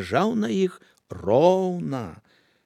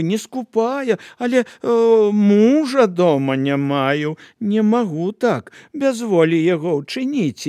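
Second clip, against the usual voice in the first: higher, wheedling sweet voice; butter them up slyly; greedy woman sweet-talks the apostles; fawning; 6 semitones higher, 5 dB louder than usual. Acted.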